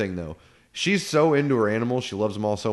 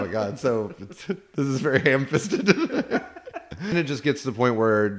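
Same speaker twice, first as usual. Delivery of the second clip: ordinary talk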